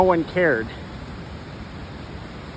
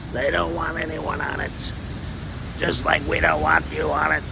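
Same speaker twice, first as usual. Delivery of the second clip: lower, gruff-ish voice of the intruders; less femmy voice; 3 semitones lower, 4 dB quieter than usual. Acted. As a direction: speak as the squatting voices, lightly. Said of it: "One that's not so, uh, femmy".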